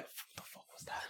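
hushed, voiceless disbelief; stunned whisper. Retold or imitated. Onlooker reacting to the scary teacher, stunned whisper. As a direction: whisper it in mock shock.

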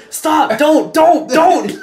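mock director yelling to stop; urgent shouting. Porn director begging the actor to hold off, urgent shouting.

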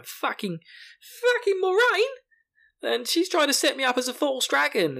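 high-pitched outraged ranting; mock tirade. Host as Rand raging about Moiraine, mock tirade. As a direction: rant in hammed-up, squealing outrage. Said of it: "breaks out into an absolute tirade".

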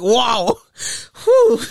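mock crying worship. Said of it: "I start crying".

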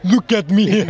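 mock big guy to the magpie; gentle giant voice. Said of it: "You just turn to the magpie".

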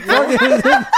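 laughing. Real, not acted. Playful howl bit.

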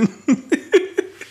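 airy high giggle; high breathy laugh. Real, not acted. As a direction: laugh it off lightly. Laughs at own joke.

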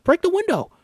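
high, loud forceful order; insistent shout. Acted. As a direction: insist with urgency. Repeats order more forcefully.

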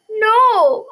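sharp high shout; a shocked cry from the crowd. Acted. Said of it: "I heard someone cry".

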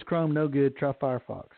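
ordinary talk

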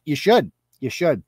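ordinary talk

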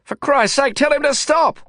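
high, urgent shout from the back seat. A narrator's reading. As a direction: yell in alarm, voice pushed way up. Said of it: "I shouted".

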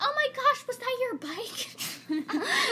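very high, slow mock concern; hammed-up clueless mother, loud and drawn out; oblivious mom. Oblivious mom asking about the bike, high mock concern. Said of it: "Daniel's mom is so oblivious".

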